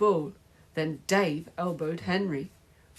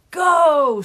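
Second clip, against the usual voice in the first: high, very loud long cry; screamed; 11 semitones higher, 12 dB louder than usual. A narrator's reading. Henry celebrating his goal, loud scream. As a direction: bellow it out in triumph. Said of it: "screamed Henry".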